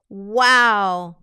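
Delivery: loud, drawn-out mock amazement; a big wow of surprise. Reacting to wordier phrasing. (asombro exagerado)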